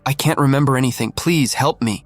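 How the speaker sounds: ordinary delivery, nothing marked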